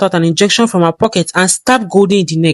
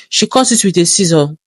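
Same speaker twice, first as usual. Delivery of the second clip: usual speaking voice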